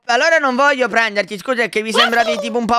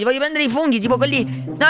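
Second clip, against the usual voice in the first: ordinary delivery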